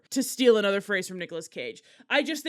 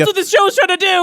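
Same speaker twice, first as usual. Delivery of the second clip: higher, louder and fast, pushing the point; excited; 9 semitones higher, 8 dB louder, faster than usual. Real, not acted. Host fires back at cohost, excited and fast. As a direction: insist it with a rush of energy.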